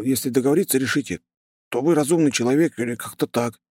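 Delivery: high, rapid nervous chatter; rattling on. A narrator's reading. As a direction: gabble it out in a rush. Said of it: "тараторил парень".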